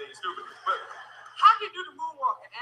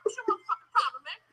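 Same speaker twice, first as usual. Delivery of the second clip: high angry Michael; 8 semitones higher than usual. Pretend. Michael squealing threats at the comedian who mocks him.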